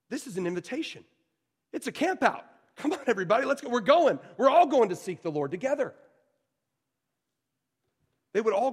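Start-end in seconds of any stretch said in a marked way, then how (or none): 1.7-5.9 high, loud rallying call; excited. Acted. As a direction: call everyone out, eager.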